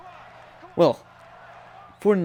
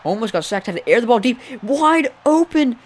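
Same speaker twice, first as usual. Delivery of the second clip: louder and much higher, wide-eyed; excited play-by-play; 10 semitones higher, 6 dB louder than usual. Real, not acted. Live play-by-play big play.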